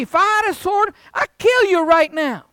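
Balaam raging at his donkey; furious threat. Acted. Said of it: "So Balaam says to his donkey".

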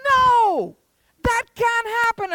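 high, loud flat-out denial; exasperated dad. Acted. Dad rejecting his son's excuse, exasperated. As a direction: burst out in acted disbelief.

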